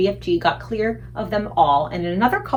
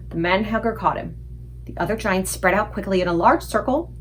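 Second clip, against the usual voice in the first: ordinary delivery, nothing marked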